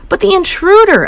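high urgent protest; insist, pushing; boy protesting to parents. A narrator's reading. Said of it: "I said".

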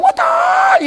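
very high, drawn-out martial-arts yelp; kung fu cry. Retold or imitated. Preacher imitating eighties kung fu movies, high battle cry. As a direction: mimic a movie fighter's piercing cry. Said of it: "kung fu movies and stuff when they go like".

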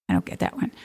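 breathy whispered aside, half to herself. Real, not acted. To the viewers, confessing she's puzzled: whispered aside. Aside while reading list.

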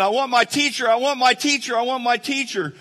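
high, loud imitation of a bawling child; hammed-up childish crying plea; mock ugly cry. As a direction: wail it over and over like a crying kid. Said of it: "this was the ugly cry".

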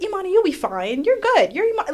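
high, loud dismissive friends' voice; mocking brushoff. Mimicking friends brushing off her pain, squealing high. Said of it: "they'll be like".

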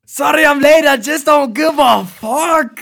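high and loud, slow, cocky; loud mock intro. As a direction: belt it out like a careless rockstar.

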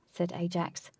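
usual speaking voice

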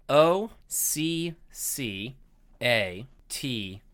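slow letter-by-letter spelling. Shown as a demonstration. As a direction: spell each letter out slowly. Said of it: "the word is occasionally".

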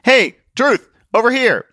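high, loud call across a crowd; calling out to get attention. Acted. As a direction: hail a friend at a distance.

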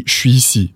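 "Je suis ici" as "shwee-see"; casual "shwee-see"; slang contraction. Shown as a demonstration. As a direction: merge "Je suis" into a "sh" sound. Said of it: "to make it slang".

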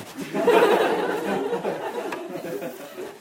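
high breathy laugh; delighted laugh. Real, not acted. Preacher laughing at the story's punchline. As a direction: let a real laugh spill out.

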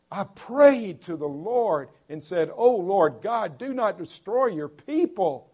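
high, loud pleading prayer read; fervent preaching. Acted. Preacher voicing Moses begging God for his people, loud pleading. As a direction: cry out Moses' plea to God with full force.

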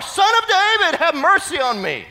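very high, loud cry; insistent shout. Acted. Silenced beggar calling to Jesus even harder, shouting. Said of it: "he shouted all the more".

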